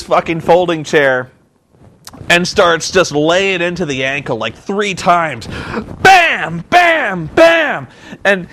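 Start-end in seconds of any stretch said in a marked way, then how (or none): 6.0-7.9 high, punchy mimed blows; hammed-up sound of repeated whacks; shouted impact noises. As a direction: shout each one like a chair hit. Imitating three chair blows.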